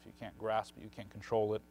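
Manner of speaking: ordinary talk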